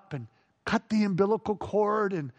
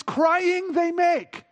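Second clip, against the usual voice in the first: "crying they make" slow and lifted; slow emphatic "crying they make"; stress on crying; 6 semitones higher, much slower than usual. Shown as a demonstration. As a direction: drag out "crying they make" to correct the lyric. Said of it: "when babies want things".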